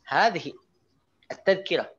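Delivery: "Hadhihi" lifted and falling, pause, then high "at-tadhkirah"; emphatic "Hadhihi", gap, "at-tadhkirah"; intonation with pause. Shown as a demonstration. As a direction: break clearly between "Hadhihi" and "at-tadhkirah". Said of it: "Ada jeda begitu pakai intonasi".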